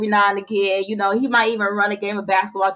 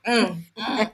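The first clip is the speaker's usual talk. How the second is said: ordinary talk, nothing marked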